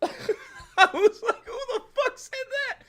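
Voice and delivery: high, slowed laughing disbelief; giggling who said that. Retold or imitated. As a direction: ask it cracking up.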